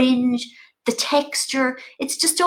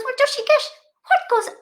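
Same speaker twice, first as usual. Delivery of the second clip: high, loud, eager mother's question; high mammy voice; 3 dB louder than usual. Retold or imitated. Her mother still hoping for a murder, high. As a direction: pitch it way up, fishing for drama. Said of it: "And she goes".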